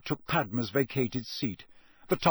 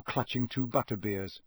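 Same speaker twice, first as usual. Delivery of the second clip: ordinary delivery, nothing marked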